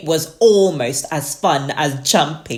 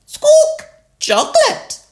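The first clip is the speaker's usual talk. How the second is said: very high, loud squawking bird; Polly Parrot; 8 dB louder than usual. A narrator's reading. Polly copies Susie's order, very high parrot squawk. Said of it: "Polly Parrot copied".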